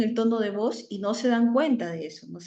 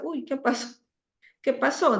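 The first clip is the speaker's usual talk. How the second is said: higher-pitched, puzzled parent; mock parental surprise; 6 semitones higher than usual. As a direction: act out a parent's mild surprise on finding something. Voices parent finding another child's pencil case. (sorpresa fingida de mamá)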